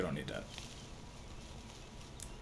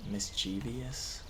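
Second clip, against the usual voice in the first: skeptical; 5 dB quieter, much slower than usual. Real, not acted. Questioning the AI's narration.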